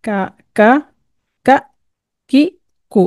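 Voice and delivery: Arabic letter drill; Qaf with vowels. Shown as a demonstration. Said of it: "हर्फ जो काफ है".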